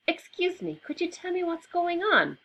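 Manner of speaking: put-on high, polite little boy. A narrator's reading. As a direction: ask it as a polite small boy. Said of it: "Albie asked".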